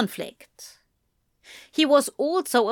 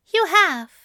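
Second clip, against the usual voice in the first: high-pitched angel voice; Aziraphale, agreeing; 10 semitones higher, 4 dB louder than usual. A narrator's reading. Angel acknowledging Crowley kept him safe, agreeing. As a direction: concede it in a high character voice.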